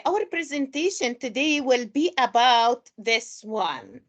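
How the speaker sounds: usual speaking voice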